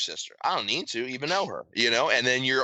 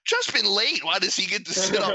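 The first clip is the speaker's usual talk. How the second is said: high-pitched imitation of resentful coworkers; mock griping; 10 semitones higher than usual. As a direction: whine about the favoritism, pitched up high. Coworkers complaining, acted in story.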